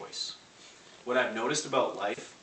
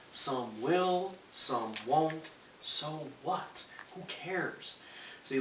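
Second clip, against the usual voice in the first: normal talking voice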